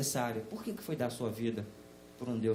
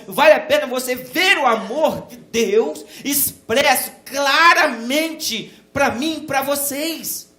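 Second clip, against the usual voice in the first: loud, rising pulpit fervour; preaching at full force; 8 semitones higher, 12 dB louder than usual. Acted. Pastor urging the church to see love, fervent preaching. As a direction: preach it out to the pews.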